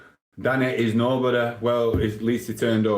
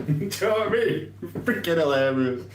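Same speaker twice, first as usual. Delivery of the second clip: laughing; 7 semitones higher than usual. Real, not acted. Laughing after the Bellew story.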